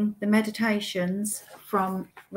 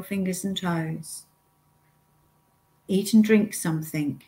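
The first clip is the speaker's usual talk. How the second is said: guided meditation. Acted. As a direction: lead the listener step by step, unhurried, pausing often.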